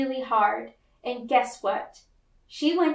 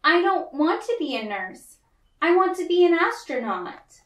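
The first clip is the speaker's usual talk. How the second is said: put-on higher, firmer little girl, insisting; determined child; 6 semitones higher, 4 dB louder than usual. May answers Miss Bell, high and insistent. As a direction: stand your ground against the teacher.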